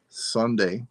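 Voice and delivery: ordinary talk, nothing marked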